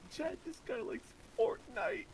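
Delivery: high, slow goofy cartoon voice; squeaky silly cartoon character; cartoon giggle voice. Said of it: "goofy cartoon giggle".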